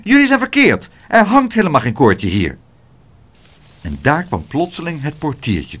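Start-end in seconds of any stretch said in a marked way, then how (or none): 0.0-2.6 squeaky cross little porter; piping, indignant and high; high angry little voice. A narrator's reading. Small porter snapping at the visitors, high and cross. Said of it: "een hoog, boos stemmetje".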